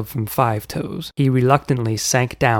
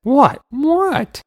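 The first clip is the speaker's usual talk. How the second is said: surprised; 13 semitones higher than usual. A narrator's reading. Uncle defensive.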